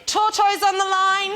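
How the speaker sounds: loud, high alarm call; shout. Retold or imitated. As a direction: yell it across the conveyor belt. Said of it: "And shouted".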